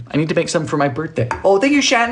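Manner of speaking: ordinary talk, nothing marked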